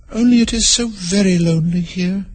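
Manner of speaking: put-on a touch of gloom, high girl; lightly melancholy Alice. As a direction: sigh over the loneliness. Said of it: "in a melancholy voice".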